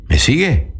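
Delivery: ordinary talk, nothing marked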